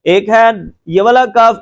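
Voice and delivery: ordinary talk, nothing marked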